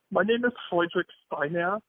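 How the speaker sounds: imitating a friend's German accent; imitated German accent. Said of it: "spot-on German accent".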